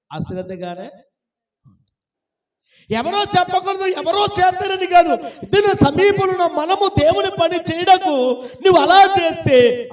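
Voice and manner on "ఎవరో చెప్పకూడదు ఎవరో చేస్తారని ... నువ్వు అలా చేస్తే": high, urgent exhortation; forceful preaching. Real, not acted. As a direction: press the duty on each listener. Exhorts the congregation. (ఉచ్చ స్వరంతో గట్టి ప్రబోధం)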